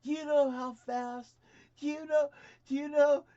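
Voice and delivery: high, loud, slow mock trooper rant; angry state trooper. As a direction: hammer the question like an outraged cop. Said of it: "And he says".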